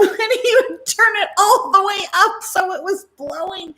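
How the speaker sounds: laughing through the words; giggling as she tells it; story told through laughter. Real, not acted. Laughing while telling story.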